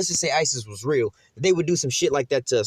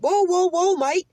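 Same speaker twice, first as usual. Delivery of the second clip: high-pitched mock British yelp; squeaky comic British accent; scared British dude; slower than usual. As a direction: imitate a panicky Brit, pitched way up. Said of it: "He's like some British dude".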